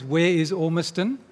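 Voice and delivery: normal talking voice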